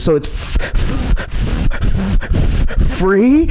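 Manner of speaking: stuck on F, bursting out high on "free"; imitating a stutter on F; imitated stutter. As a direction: stammer the F repeatedly, then pop up into a question. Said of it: "he would stutter on just one letter".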